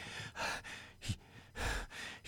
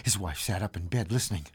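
ordinary delivery

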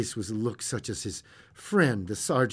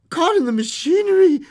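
stunned, high and loud echo; dazed; 13 dB louder than usual. A narrator's reading. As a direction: repeat it in shock.

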